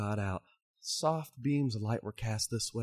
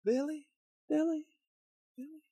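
the name echoing through empty caverns. Acted. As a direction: let the call ring back faintly. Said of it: "the name just echoed".

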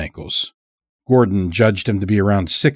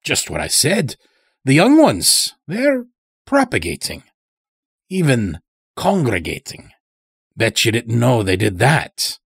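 put-on higher-pitched rustic old-timer; old farmer Morris, insistent; 4 semitones higher than usual. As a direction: play a folksy old man spreading ominous news. Old captive farmer speaks in story.

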